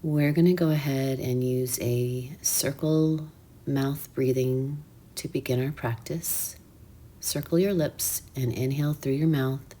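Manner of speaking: soft, hushed meditation voice; quiet, soothing breathwork instruction with unhurried pauses; guided meditation. Real, not acted.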